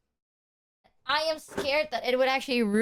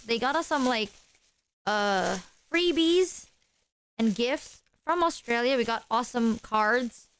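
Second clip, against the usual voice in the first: ordinary talk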